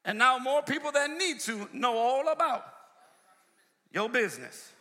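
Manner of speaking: high, driving sermon delivery; full-force preaching. Real, not acted. Preacher warning against sharing a friend's confidence, full-force preaching. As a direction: preach it hard, stretching the key words.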